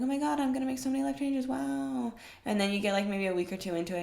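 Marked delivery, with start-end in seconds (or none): 0.0-2.1 mock excitement. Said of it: "you're like".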